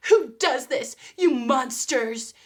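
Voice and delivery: mock outraged yell; indignant outrage. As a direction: hurl the accusation at the camera. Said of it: "they'll be like".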